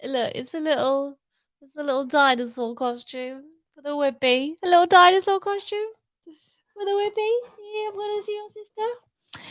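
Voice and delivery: put-on high, sing-song baby talk to the dog; cutesy dog-talk. Showing the dog its costume, cooing high baby talk. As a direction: coo it like talking to a puppy.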